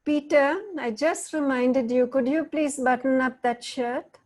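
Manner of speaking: sweet words over a threatening tone; irritated reminder. Shown as a demonstration. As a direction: remind him with an edge of impatience. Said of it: "What's the tone you all are hearing?".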